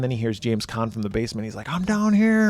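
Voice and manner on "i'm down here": high-pitched call from below; retold cry for help, pitched well up; mock distant holler. Captive calling from the basement.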